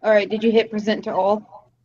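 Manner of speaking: normal talking voice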